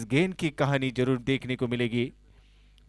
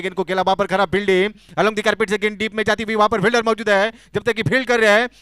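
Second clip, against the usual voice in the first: excited cricket commentary; 5 semitones higher, 5 dB louder than usual. Real, not acted. Live commentary, misfield drama.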